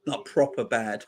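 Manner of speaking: ordinary delivery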